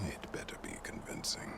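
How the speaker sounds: soft, breathy threat; quiet cold menace; menacing whisper. Acted. Threatening a captive with soldiers.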